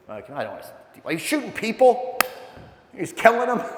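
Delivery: usual speaking voice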